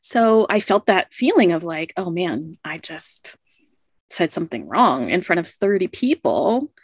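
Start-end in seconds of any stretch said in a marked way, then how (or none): none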